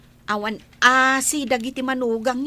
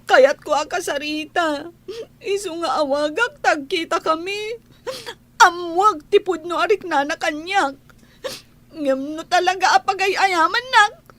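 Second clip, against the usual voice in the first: crying plea. Acted. Daughter begging her mother to let her see Sir Frank, crying. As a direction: beg through tears.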